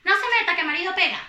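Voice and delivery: lightly voiced woman telling a stranger to butt out; quoted woman. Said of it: "la tipa le dice".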